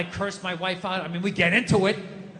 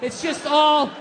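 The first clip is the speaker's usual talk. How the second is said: ranting; 6 semitones higher than usual. Real, not acted. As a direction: rant it out, worked up. Ranting about wife's criticism.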